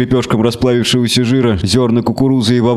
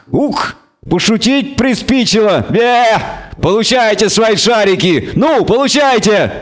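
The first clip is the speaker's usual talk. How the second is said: angry goat creature; 9 semitones higher, slower than usual. Acted. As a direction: rant and bleat like a furious goat. Creature rants, throws iron balls.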